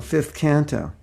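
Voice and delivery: ordinary talk, nothing marked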